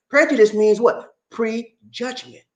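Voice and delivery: ordinary delivery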